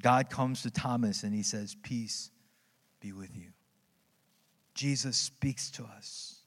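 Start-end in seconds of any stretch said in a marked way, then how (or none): 1.8-3.5 soft, lower, tender words of peace; gentle blessing. Retold or imitated. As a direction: speak peace quietly to the doubter. Jesus greets Thomas.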